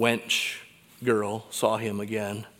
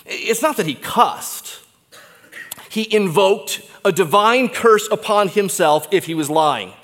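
normal talking voice

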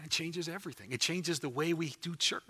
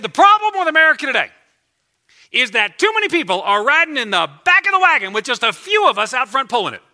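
much higher, louder Perot voice; Ross Perot impression; 11 semitones higher, 15 dB louder than usual. Pastor performs his Ross Perot imitation for laughs. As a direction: announce it like Perot with big ears. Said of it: "let me do my Ross Perot imitation".

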